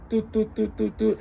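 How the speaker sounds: high, soft mimicked ringing tone; phone ring noises.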